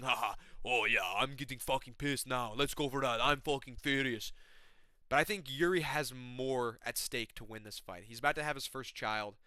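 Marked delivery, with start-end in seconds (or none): 0.0-4.3 taunting, lightly voiced fighter; mock grinning fighter. Yuri grinning at his opponent, mock taunting. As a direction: tease him with a grin in the voice. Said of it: "He's smiling in my face, laughing".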